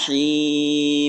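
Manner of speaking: "him" sung long with the m merged in; idgham demo. Shown as a demonstration. Teacher showing how "him" merges, drawn out and sung. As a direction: hold "him" as a smooth nasal merge, not pressed. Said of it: "tapi nggak pakai ditekan".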